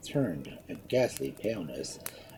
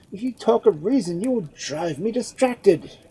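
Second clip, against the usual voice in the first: agitated protest; 12 semitones higher, 8 dB louder than usual. A narrator's reading. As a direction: press the words out in distress. Edmund agitated.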